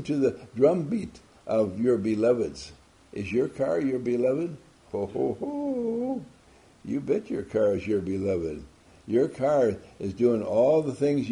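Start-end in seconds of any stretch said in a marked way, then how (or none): 4.9-6.2 high, slow ho ho ho; a big slow mock-jolly laugh. Real, not acted. Laughing at own joke.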